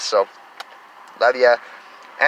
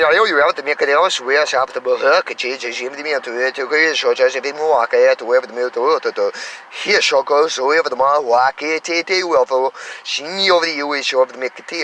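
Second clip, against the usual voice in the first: light language. Shown as a demonstration. He shows his viewers the light language he channels. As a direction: let the made-up language stream out. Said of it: "I got this light language thing coming through me".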